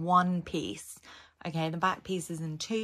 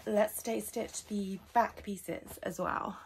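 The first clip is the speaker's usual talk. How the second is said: ordinary delivery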